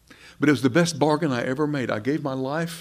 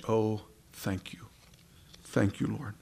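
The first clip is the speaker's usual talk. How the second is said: hushed thanks; 2 semitones lower, 6 dB quieter than usual. Pretend. Imagined prayer at Jesus' feet.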